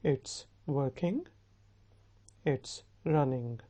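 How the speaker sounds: slow, separated words for learners; slow model phrases. Shown as a demonstration. Teacher modelling phrases to say together, slow and measured. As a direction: say it slowly for repeating.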